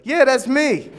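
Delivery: put-on kid voice. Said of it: "that kid's like".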